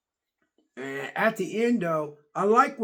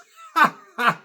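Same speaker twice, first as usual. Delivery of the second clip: loud real laugh at the joke; burst of laughter; 6 semitones higher, 9 dB louder than usual. Real, not acted. Laughing hard at the old man's line. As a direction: crack up out loud.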